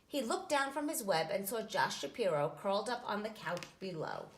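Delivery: ordinary delivery, nothing marked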